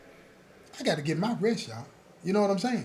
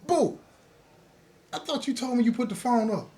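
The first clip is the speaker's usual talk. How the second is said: scolding; 5 semitones higher than usual. Retold or imitated. Scolding son under blanket.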